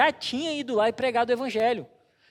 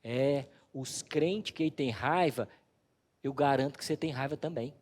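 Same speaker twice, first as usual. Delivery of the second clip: Jesus speaking; 6 semitones lower, 4 dB quieter than usual. Retold or imitated. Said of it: "Aí Jesus falou assim". (Jesus fala baixo e devagar)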